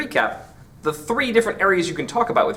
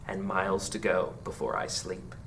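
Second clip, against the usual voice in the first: much softer, settling echo of the line; fading final line; 7 semitones lower, 11 dB quieter than usual. Acted. Teacher ending the poem on its echoing last line, fading softly. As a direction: let the repeat sink away into near silence.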